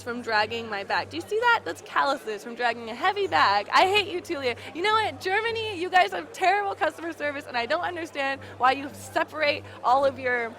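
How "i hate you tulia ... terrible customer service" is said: high, indignant rant at Germany; mock outrage. Real, not acted. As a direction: scold the whole country half-jokingly. Ranting at Germany, pitch high.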